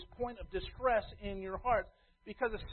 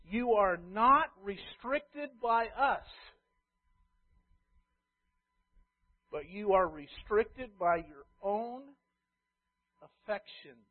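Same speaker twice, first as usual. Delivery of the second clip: slow, deliberate scripture reading, each word weighed; slow solemn reading; slower than usual. Shown as a demonstration. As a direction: read it slowly so it stings. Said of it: "now listen, here's the truth, and it's gonna sting".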